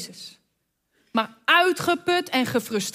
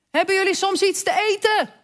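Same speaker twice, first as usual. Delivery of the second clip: Jezus roept; 7 semitones higher than usual. A narrator's reading. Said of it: "Hij riep".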